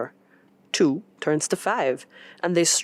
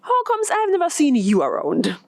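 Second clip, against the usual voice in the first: higher, louder teasing ask; a lively, playful coworker; joking question; 11 semitones higher, 3 dB louder than usual. Acted. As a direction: tease her playfully. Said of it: "asks jokingly".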